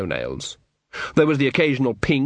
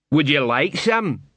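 dotty bursar; 3 semitones higher, slower than usual. Acted. Bursar offering cheerfully.

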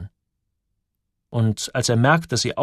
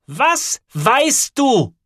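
high, drawn-out, knife-sharp shout; sharp, loud demand; 13 semitones higher, 6 dB louder, much slower than usual. A narrator's reading. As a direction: cut into each word, high and slow. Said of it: "Ihre Stimme klingt scharf".

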